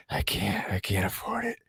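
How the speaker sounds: pained whisper. Retold or imitated. Retold, breathless with chest pain.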